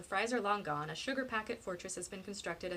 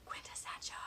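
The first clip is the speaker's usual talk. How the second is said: whisper; 7 dB quieter than usual. A narrator's reading. Narrator teases Sophia with the hated word, whispering. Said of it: "I'm gonna whisper it in your ear".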